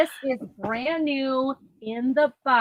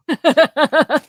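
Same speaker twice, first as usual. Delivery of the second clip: laugh; 4 semitones higher, 7 dB louder than usual. Real, not acted. Laughs at her own snow baby joke.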